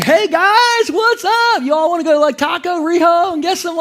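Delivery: high, casual, cheery greeting to his brothers; carefree Joseph. Acted. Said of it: "He's like".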